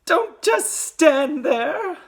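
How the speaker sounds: high, drawn-out scream; slow, piercing scold; Missus Gloop. A narrator's reading. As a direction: scream it at a useless husband. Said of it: "Missus Gloop screamed".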